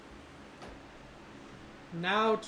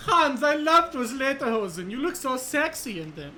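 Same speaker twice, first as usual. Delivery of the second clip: higher, hammed-up camp German; camp German accent; 4 semitones higher than usual. Shown as a demonstration. Game master showing his only German accent, camp and flirty.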